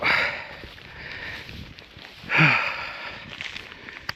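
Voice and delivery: long breathy sigh of relief; tired sigh. Real, not acted. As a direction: let out a weary exhale after the climb. Reaching the top after a climb.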